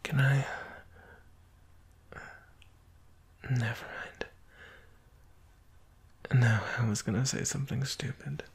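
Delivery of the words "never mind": soft, breathy retreat; hesitant whisper. Acted. Lover backing off an intimate request, hesitant whisper. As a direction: drop to a whisper, taking the request back.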